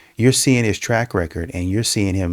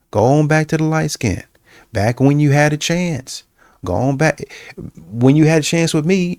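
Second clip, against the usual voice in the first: indignant rejection; 6 semitones higher than usual. Retold or imitated. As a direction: tell him off, getting more outraged with every line. Acted woman rejecting him.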